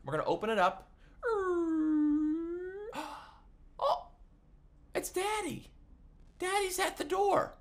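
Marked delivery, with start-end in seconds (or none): 1.2-3.1 big mock surprised gasp. Entertainer opens the door for the kids, mock gasp. 3.8-7.5 high, excited squeal of surprise; mock surprise. Entertainer reveals Daddy to the kids, high mock surprise.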